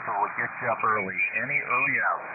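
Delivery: normal talking voice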